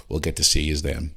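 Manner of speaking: usual speaking voice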